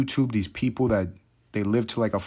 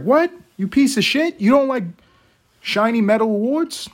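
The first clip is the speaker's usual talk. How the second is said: high, loud outraged mockery; mock outraged world; 11 semitones higher, 5 dB louder than usual. Mocking the world that hates the guy, high outraged yell. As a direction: shriek it like the offended world. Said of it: "The world is like".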